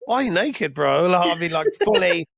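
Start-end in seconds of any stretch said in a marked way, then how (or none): none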